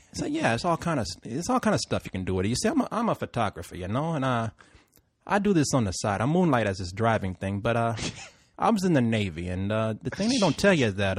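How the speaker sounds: low, quiet, rambling Puerto Rican cabbie; softly imitated Puerto Rican driver telling his story; bad Puerto Rican accent. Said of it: "I'm gonna do it bad accent".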